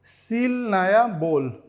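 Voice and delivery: slow, loud, drawn-out reading; slow demo. Shown as a demonstration. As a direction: say it slowly and clearly for students. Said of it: "तो क्या बोलेंगे?".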